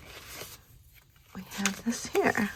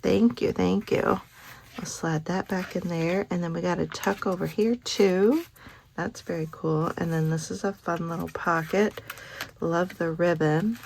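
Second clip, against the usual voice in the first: ordinary delivery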